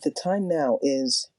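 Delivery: slow, evenly spaced words; slow clock readout. Shown as a demonstration. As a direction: spell it out like a talking clock. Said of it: "Let's play that".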